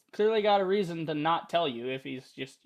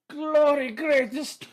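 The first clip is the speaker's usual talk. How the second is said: slow, loud, higher mock cry; hammed-up proclamation; 8 semitones higher, 6 dB louder, much slower than usual. Player mocks Alex's great honor, hammed-up. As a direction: draw it out grandly for laughs.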